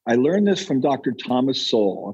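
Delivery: ordinary talk, nothing marked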